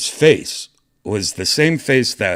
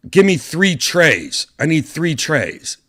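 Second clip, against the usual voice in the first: slow, loud and higher, each word pressed; urgent demand; 9 semitones higher, 7 dB louder, slower than usual. Retold or imitated. Doctor demanding trays from the stewardess, slow loud command. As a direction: hammer out the order to the crew.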